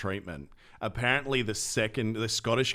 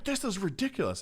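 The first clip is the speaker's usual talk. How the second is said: exasperated protest; 11 semitones higher than usual. Retold or imitated. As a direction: imitate an indignant customer protesting. Same customer protesting the wait.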